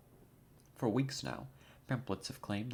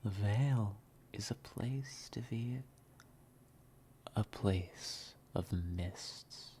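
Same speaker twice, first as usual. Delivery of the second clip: mysterious intro narration; 3 dB quieter than usual. Acted. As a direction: draw the listener in through the mist, half whispering. Dramatic whispered intro narration.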